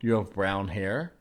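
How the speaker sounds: normal talking voice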